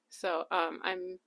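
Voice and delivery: ordinary delivery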